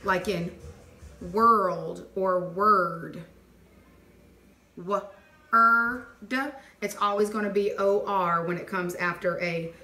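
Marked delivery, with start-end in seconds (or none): 1.3-3.2 "world or word" drawn out very slowly to show the er sound. Shown as a demonstration. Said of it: "what makes the er sound". 4.8-6.4 "W-er-d" split into its sounds, a bit louder; sounding out. Shown as a demonstration. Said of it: "what makes the er sound".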